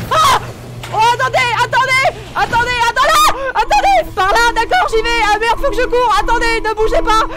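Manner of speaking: loud frantic panic, voice high; panicked shouting. Real, not acted. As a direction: yell in real panic while fleeing. Chased in horror game, panicking.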